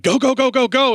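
high, excited chant of go; like a body raring to run; eager go voice. Retold or imitated. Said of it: "so the rest of me is like".

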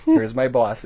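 usual speaking voice

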